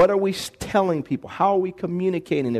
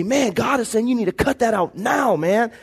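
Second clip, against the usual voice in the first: passionate rebuke; 4 semitones higher than usual. Retold or imitated. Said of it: "look 'em in the eye and say".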